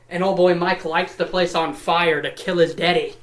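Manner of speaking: ordinary talk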